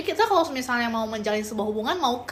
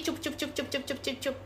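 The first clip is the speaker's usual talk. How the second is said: soothing hush; 10 dB quieter than usual. Real, not acted. Comforting a crying viewer.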